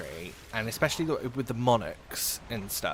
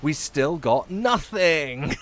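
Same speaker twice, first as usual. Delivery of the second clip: slow, louder stretched-out complaint; drawn-out disbelief; 4 semitones higher, 5 dB louder, much slower than usual. Real, not acted. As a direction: drag every word out in mock despair. Loot came up empty again.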